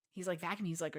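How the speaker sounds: ordinary talk, nothing marked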